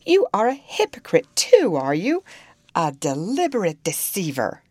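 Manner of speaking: high, loud accusation, easing toward the end; indignant outburst. A narrator's reading. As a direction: hurl the accusation, then settle. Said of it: "I ejaculated".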